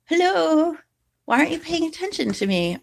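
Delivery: high, fast cat voice for the pet; high cutesy kitten voice; voicing the cat. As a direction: speak for the kitten in a high squeak. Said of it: "He's like".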